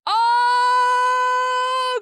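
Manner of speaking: long, loud, high "ohhh"; loud, sustained howl of frustration; exasperated wail. Acted. Outburst after the man's remark.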